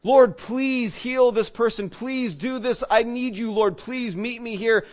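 high, slow, imploring prayer; fervent mock plea, pitched high; acted tearful pleading. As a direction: beg as if praying through tears. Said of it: "we're crying, we got the tears running".

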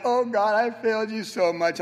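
hammed-up anguish; mock despair. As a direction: moan like a repentant failure. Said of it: "I was like".

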